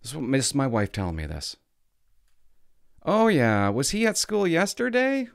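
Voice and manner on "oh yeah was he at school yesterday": high, slow, sing-song fake teacher; mock her falsely sweet voice; a high sugary teacher imitation. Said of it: "I don't know why she's talking like this".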